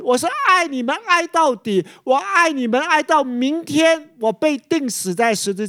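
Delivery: "爱" lifted high and pressed hard, each time it comes; stress on 爱. Real, not acted. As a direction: lean into every "爱", well above the speaking voice. (重读"爱"字，每个"爱"都高高托起)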